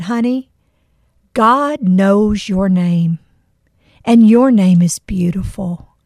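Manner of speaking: slow, hushed, loving reassurance; tender comfort. Retold or imitated. Reassuring the nameless girl, slow and tender. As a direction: soothe her, ending on a whisper.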